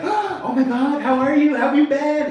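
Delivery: high, loud, gushing hello with a laugh; gushing fake greeting. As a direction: squeal it like a fake-delighted relative. Everyone gushing at the party.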